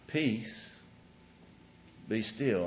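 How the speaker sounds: quiet, unhurried, simply spoken; calm spoken command. Shown as a demonstration. The right way Jesus calmed the storm, calmly spoken. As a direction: say it with calm authority, no shouting. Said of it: "He stood up and he spoke".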